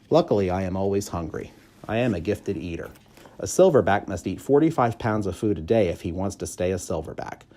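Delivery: plain reading voice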